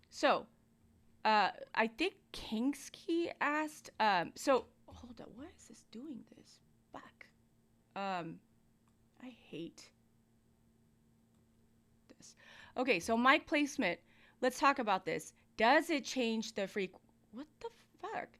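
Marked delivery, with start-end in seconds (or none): 4.8-8.4 whispered to himself, frustrated; whispered aside. Real, not acted. Muttering to self off mic. 9.2-12.4 whispered to himself, frustrated; whispered aside. Real, not acted. Muttering to self off mic. 17.3-18.3 high, soft startled aside; a surprised half-whispered curse. Real, not acted. Tech trouble exclamation.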